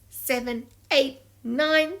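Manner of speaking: ordinary delivery, nothing marked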